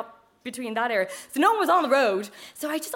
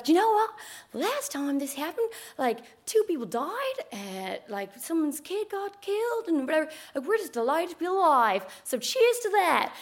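higher put-on voice of a local; animated mock-local chat, lifting at the end; cheery local; 7 semitones higher than usual. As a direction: imitate a carefree survivor telling the tale. Imitates a local's cheerful reply.

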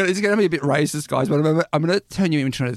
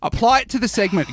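getting mad; 7 semitones higher than usual. Real, not acted. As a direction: spell it out to the uncooperative machine, exasperated. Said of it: "Don't get mad".

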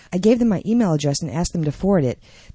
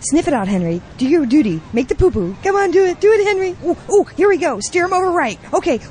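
talking to a dog; 11 semitones higher, 5 dB louder than usual. Acted. Cheering dog on.

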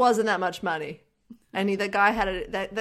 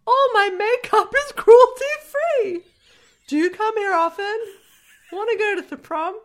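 chirpy toy doll line; talking doll; 10 semitones higher, 7 dB louder than usual. Retold or imitated. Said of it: "This is what the Gwendy doll said".

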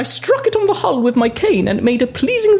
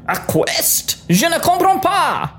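put-on French accent; slower than usual. Frenchman confused.

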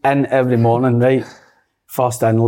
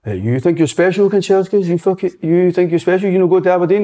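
raging, higher-pitched mock rant at the player; angry manager; 7 semitones higher than usual. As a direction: imitate a furious boss raging at a star. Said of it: "storming down".

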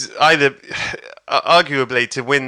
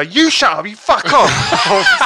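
loud imitated shouting back; mock angry heckle, laughing at the end; crowd retort; 5 semitones higher, 6 dB louder, slower than usual. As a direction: hurl it back at the other half. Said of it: "then the other half of the crowd".

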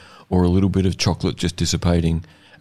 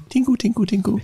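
tinkly high voice; 13 semitones higher than usual. Shown as a demonstration. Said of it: "hits you like a little sparkling".

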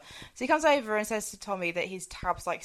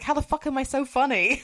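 higher, louder mock outrage; retold anger; 6 semitones higher, 6 dB louder than usual. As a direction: act out an angry challenge, voice raised. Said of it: "Gets really angry".

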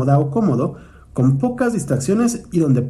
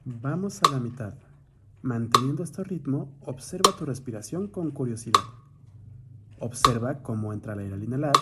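much softer, calm meditation guidance; guided meditation; 12 dB quieter than usual. Acted. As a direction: ease them back to the breath softly. Guía la meditación en voz baja. (voz de meditación guiada, mucho más suave)

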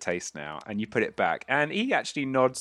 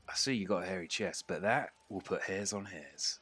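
low, quiet, quick bartender; bartender character; 3 semitones lower, 9 dB quieter than usual. Acted. Game master voices gruff barkeep.

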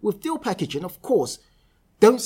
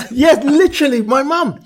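real laughter through the words, higher and louder; laughing; 8 semitones higher, 9 dB louder than usual. Real, not acted. Guest laughing as he tells the host it was his mum. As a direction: laugh while confirming it.

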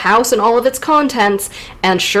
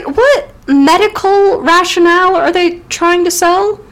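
ordinary delivery

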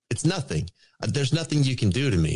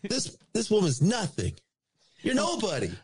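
mock dismissive voice; 10 semitones higher than usual. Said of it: "This girl".

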